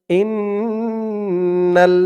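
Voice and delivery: slow, melodic tajweed recitation; slow Quranic chanting. Shown as a demonstration. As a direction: chant each word long and drawn out. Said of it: "নুন লাম জবর নাল".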